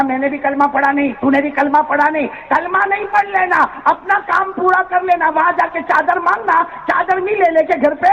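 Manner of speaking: high, insistent advice; urging stranger. Acted. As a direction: press the boy urgently. Stranger advises boy.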